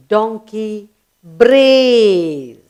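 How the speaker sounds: "brays" stretched very long and loud; very slow, with a long swelling "brays"; drawn-out brays. Shown as a demonstration. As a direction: hold the vowel of "brays" like a donkey's call. Teacher demonstrates the donkey's sound word.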